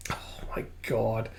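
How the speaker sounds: very soft, awed aside; stunned hush. Real, not acted. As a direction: say it under your breath in shock. Reader's stunned reaction to the scene.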